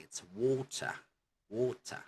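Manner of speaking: British "water", T clearly pronounced; demonstrating British "water", crisp T; British accent. Shown as a demonstration. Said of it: "clearly pronounced".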